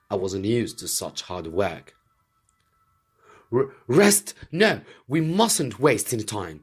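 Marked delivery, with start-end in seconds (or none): 3.5-6.6 high, loud, stammering protest; indignant eagerness. Acted. Fritz protests against resting, eager to attack. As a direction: push hard to act now.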